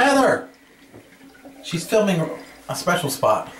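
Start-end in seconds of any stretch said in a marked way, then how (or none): 0.0-0.5 calling out. Real, not acted. Calling to friend across room.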